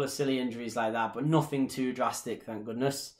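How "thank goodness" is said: ordinary delivery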